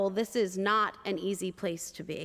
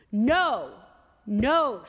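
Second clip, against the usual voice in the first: louder forceful no; anguished refusal; 6 dB louder than usual. Retold or imitated. Grieving woman pounding the desk, forceful refusal. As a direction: cut him off with force.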